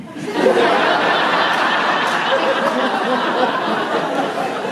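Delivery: long, loud, breathy peal of laughter. Real, not acted. Priest laughing hard at the father's joke, long and loud. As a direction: let a long laugh roll out. Laughing at criminal mind line.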